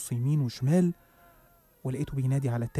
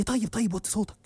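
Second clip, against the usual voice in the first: mock yell; 7 semitones higher than usual. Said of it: "أدهم زعق فيا".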